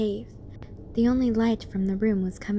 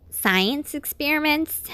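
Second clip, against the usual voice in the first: complaining; 6 semitones higher, 5 dB louder, slower than usual. Acted. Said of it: "Dash complained".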